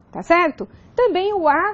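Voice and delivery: ordinary talk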